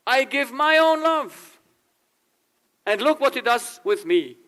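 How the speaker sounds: hurt lover, voice up high and dragged out. Retold or imitated. Rejected lover complains his love was wasted, high and hurt. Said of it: "Because you think".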